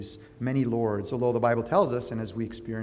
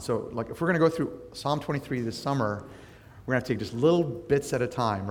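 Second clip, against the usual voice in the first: ordinary talk, nothing marked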